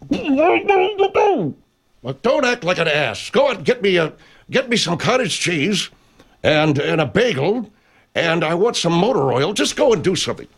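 high, comic ordering-about voice; high bossy character. Acted. Bickering with Bud about errands, high-pitched and exasperated. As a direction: reel off bossy demands way up high.

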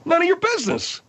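very high-pitched, indignant refusal; exasperated outburst. Acted. Host pressed about his private phone, exasperated outburst. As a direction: cut him off, voice leaping up.

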